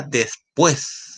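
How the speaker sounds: después said deliberately, weight on -pués; careful teacher's después, stressed at the end; stress on final syllable. Shown as a demonstration. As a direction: lean on the last syllable of después. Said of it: "la fuerza de voz está en la última sílaba".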